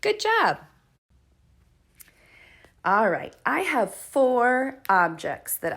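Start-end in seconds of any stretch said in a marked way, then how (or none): 0.0-0.6 bright high praise; cheerful praise. Real, not acted. Teacher to preschoolers, cheering a right answer.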